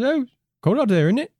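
high, hammed-up British pub talk; mock UK accent. Host voicing himself inviting the alien to the pub, mock British. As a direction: play a chummy Brit inviting him out. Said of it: "our various UK accents".

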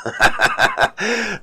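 short run of laughter. Real, not acted. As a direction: laugh at a partner's annoyance. Laughs at her being annoyed.

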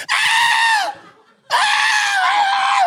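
high, shrill mock scream of fear; a scared neighbor screaming at a dog; terrified scream. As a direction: scream like a man scared of dogs. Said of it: "the first thing he did was".

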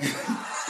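unvoiced snicker, just like that; soft mock cackle; breathy laugh demo. Shown as a demonstration. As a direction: show the sleep laugh in a breath. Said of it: "yes, just like that".

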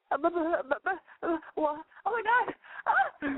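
stammering, rising to a high squeak; flustered freakout. Retold or imitated. Host imitating a girl flustered by a guy's look, stammering high. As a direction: babble like you've lost all words. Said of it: "and you freak out".

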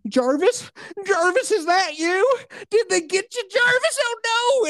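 high, drawn-out mock panic; hammed-up horror at finding a friend dead; alarmed mock cry. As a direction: call out in high-pitched dismay for your fallen pal. Acting a man finding his friend's body.

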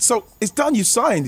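high, eager asking; acted-out eager disbelief; retold excited question. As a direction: ask it thrilled, pitched well up. Acts out excited question to signing.

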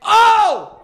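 high, loud shocked yelp; loud high shocked yelp at the video; shocked cry. Real, not acted. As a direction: yelp in disbelief. Reacting to the video.

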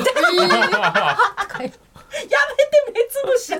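high laughing protest; laughing shout. Real, not acted. Laughing at eye poke.

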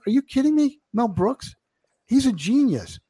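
high-pitched, indignant disbelief; incredulous protest. Acted. Comic defending Mel Brooks to a would-be boycotter, incredulous. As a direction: protest it as if the objection were absurd.

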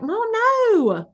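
put-on high, stretched Southern child voice; Southern kid accent. Southern kid protesting to mom, high Southern drawl. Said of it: "a really good Southern accent".

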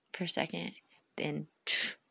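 usual speaking voice

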